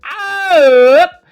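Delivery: big drawn-out excited call on "up"; hyped-up verdict. Acted. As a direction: belt the verdict like a showman.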